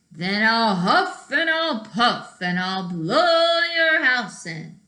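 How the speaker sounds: threatening wolf. Acted. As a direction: make it a big bad wolf threat.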